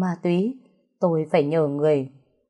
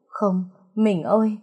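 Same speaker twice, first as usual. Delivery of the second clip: anguished cry; 3 semitones higher than usual. A narrator's reading. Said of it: "mẹ tôi hét lên".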